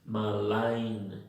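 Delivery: "Malign" slowly, with the second syllable carrying the stress; malign drawn out. Shown as a demonstration. Teacher helping students find the schwa, "Malign" drawn out. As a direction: stretch "Malign" so the weak first vowel shows. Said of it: "la que lleva la fuerza".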